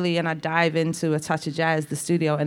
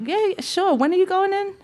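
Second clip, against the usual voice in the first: much higher, squeaky put-on phone voice; starstruck phone voice; 13 semitones higher than usual. Singer re-enacting her call with a superstar, high starstruck voice. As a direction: squeak it out, acting casual on a big call.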